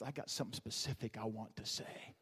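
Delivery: slow, soft, hushed divine voice; voice of God. Acted. God calling Ezekiel to speak, hushed and slow. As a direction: let God speak low and slow, fading to a whisper. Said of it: "when God calls Ezekiel to speak, he says".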